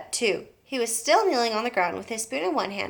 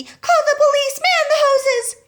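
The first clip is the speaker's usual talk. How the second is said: panicked shout; 8 dB louder than usual. A narrator's reading. Panicked wife.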